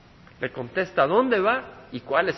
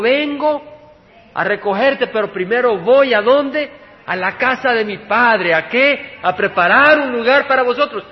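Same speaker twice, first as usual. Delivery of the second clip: loud, raised preaching voice; forceful preaching; 5 semitones higher, 7 dB louder than usual. Acted. Preacher quoting Jesus to the congregation about the Father's house, forceful preaching. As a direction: drive the scripture home, loud and high.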